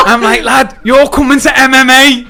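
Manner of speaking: 2 loud high laughing shout; 3 shout the challenge through laughter; 4 calling out Sanchez in the ring, laughing; 1 laughing callout. Retold or imitated.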